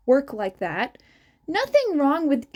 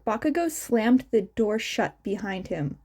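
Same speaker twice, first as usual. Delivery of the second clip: ordinary delivery, nothing marked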